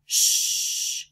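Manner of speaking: whispered hissing "shh"; "shh" modelled for the class to copy; sh sound. Shown as a demonstration. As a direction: push out a long breathy "shh". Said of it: "you say it".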